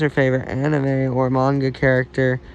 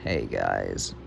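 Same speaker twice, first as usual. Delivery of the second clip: failed attempt at a very low, soft character voice; Lucy; 8 dB quieter than usual. Reader trying out a character's greeting, very low put-on voice. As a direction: drop far down for a botched character greeting. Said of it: "I can't do Lucy's voice".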